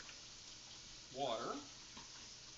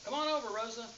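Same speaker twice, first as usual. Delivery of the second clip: calling out; 8 semitones higher, 8 dB louder, faster than usual. Real, not acted. Calling someone over loudly.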